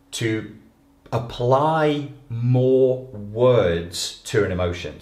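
ordinary talk, nothing marked

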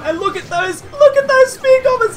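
excited yell at spear goblins. Real, not acted. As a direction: rise into a yelp of delight. Excited pointing out.

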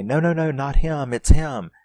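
urgent shout. Retold or imitated. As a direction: shout to stop her shooting the wrong one. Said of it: "Karen has to say".

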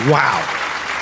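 ordinary talk

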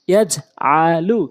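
each syllable set apart; slow demo. Shown as a demonstration. Teacher reading so learners can follow, slowly. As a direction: break the word into long, slow pieces. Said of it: "slow বলছি এই জন্য".

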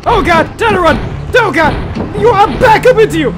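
loud, high screams, half playing at terror; screaming panic. Real, not acted. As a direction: shriek and flee, half joking. Chase, screaming in mock terror.

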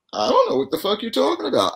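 put-on high, quick, wide-eyed innocent kid; really innocent. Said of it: "I mean, really innocent".

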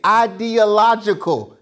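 ideological drawn out slowly, syllable by syllable; careful, slow ideological. Shown as a demonstration. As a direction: take your time through every syllable of ideological. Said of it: "It just gotta go slower".

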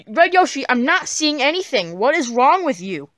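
irritated outburst at a friend; exasperated. Acted. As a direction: scold in loud disbelief, starting high. Fed up with friend's invisible companion.

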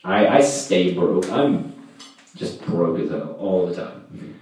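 ordinary delivery

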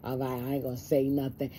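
soft, muttered giving-in to herself; resigned inner voice. Retold or imitated. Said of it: "still hanging in there".

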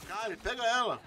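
calling out. Real, not acted. Calling teammate in game.

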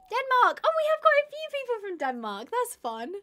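loud, high, delighted greeting; thrilled to see Denmark in the chat; excited. Real, not acted. Reading countries from chat.

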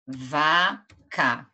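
"vaca" split as va... ca; syllable by syllable. Shown as a demonstration. Teacher announces the word for counting syllables, syllable by syllable. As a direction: say "vaca" with a clear gap in the middle. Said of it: "Então, vamos fazer".

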